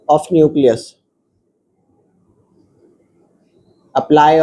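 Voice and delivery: every word spelled out slowly for students to copy; slow dictation. Acted. Teacher dictating the definition to students, very slow. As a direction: dictate it word by word so they can write.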